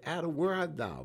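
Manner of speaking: a lightly voiced God, called out high; God calling out. God calling Adam in garden.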